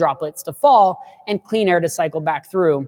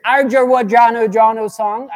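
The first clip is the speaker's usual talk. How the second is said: usual speaking voice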